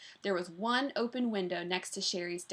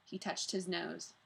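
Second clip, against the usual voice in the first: ordinary delivery, nothing marked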